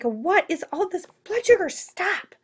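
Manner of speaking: high, alarmed cartoon kidney voice; squeaky mock outrage; kidneys voiced. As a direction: play the panicked kidneys. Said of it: "They're like".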